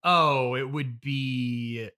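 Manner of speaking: ordinary delivery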